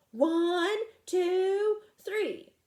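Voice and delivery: high loud count. Acted. Fred counts the second push, high loud count. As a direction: count them in, strong.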